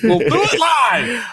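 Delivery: high, drawn-out shout; catchphrase shout. Acted. Co-host hollering the show onward, high mock shout.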